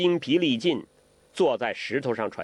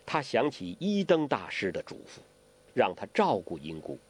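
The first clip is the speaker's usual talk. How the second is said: usual speaking voice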